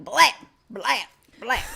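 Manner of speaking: punchy spoken blam blam blam; shotgun sound. Retold or imitated. Storyteller imitating Nathan's shotgun, punchy blams. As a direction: fire off the words like shots. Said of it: "Nice shotgun sound".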